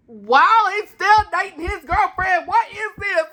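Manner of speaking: half-shouted, half-laughing disbelief; laughing outrage. Real, not acted. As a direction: yelp it through a laugh. Outburst at the mistletoe kiss.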